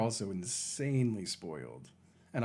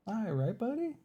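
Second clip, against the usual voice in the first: much higher, sing-song voice for the cat; high pet voice; 13 semitones higher than usual. Real, not acted. Streamer calling her cat in a high sing-song pet voice.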